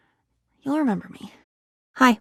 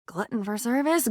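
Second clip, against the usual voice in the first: ordinary talk, nothing marked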